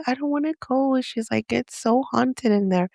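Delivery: put-on high, soft little-kid plea; imitated crying child. Scared daughter refusing the haunted tour, lightly imitated tearful voice. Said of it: "she was, like, crying".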